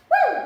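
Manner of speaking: high loud whoop to hype the video; excited whoop. Real, not acted. Fitness vlogger to viewers, high loud excited whoop. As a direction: let out a big excited woo.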